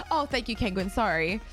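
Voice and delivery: ordinary delivery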